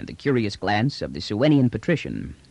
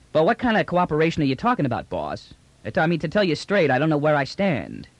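fast, higher-pitched slangy trader; quick, informal trader's patter; brash trader, puzzled; 5 semitones higher than usual. Acted. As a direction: rattle it off like a trader angling for terms. Trader questions the general.

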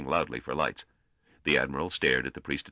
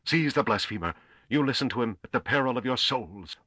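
higher, forceful furious command; 8 semitones higher, 3 dB louder than usual. A narrator's reading. Priest interrupting the admiral to have him seized, furious. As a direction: cut in furiously with an order. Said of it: "Apparat interrupted furiously".

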